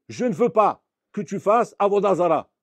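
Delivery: emphatic command. Acted. God insisting to Moses he was clear, loud. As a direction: drive home every word.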